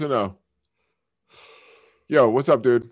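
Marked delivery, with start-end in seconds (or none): none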